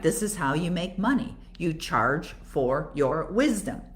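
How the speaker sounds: usual speaking voice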